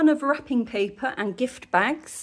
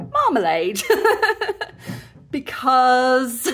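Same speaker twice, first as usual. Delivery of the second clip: amused, with laughter spilling out after each word; laughing; 5 dB louder, slower than usual. Real, not acted. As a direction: break into real giggles between the words. Amused by the unlikely request.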